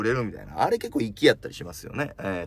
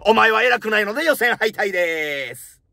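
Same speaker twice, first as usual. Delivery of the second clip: raised, louder announcer-style ruling; mock judge verdict; 8 semitones higher, 6 dB louder than usual. Comedian announcing the judge's ruling, mock judge. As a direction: hand down the verdict like a contest judge.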